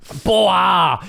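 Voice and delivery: high, hammed-up foreign cartoon voice; Poha Jirafa. Co-host revives his character as a French guy, high hammy voice. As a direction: call it out in broken mock French. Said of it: "It's a little Poha Jirafa".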